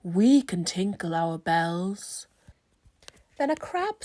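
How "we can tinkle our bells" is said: mooing cows. Acted. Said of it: "mooed the cows".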